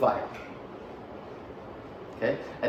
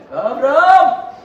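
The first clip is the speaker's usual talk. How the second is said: much higher, much louder slow shriek in a put-on Mesopotamian accent; Mesopotamian accent scream; 12 dB louder, slower than usual. Father finding the smashed idols, screaming in a Mesopotamian accent. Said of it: "he screamed in his Mesopotamian accent".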